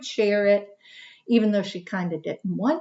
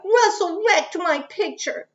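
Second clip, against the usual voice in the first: crying out; 8 semitones higher than usual. A narrator's reading. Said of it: "Jamaica cried".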